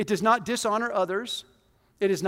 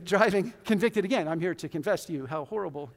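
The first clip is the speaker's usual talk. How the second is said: normal talking voice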